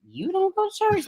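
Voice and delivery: very high-pitched, astonished comeback; retold incredulity. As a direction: throw the disbelief back, mimicking shock. Acting out her shock back.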